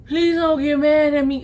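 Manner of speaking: thick-tongued, droning impression of a friend; imitated slurred friend. Friend on the phone pleading, mimicked impaired speech. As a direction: talk as if the tongue is too swollen. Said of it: "So this is how she sounds".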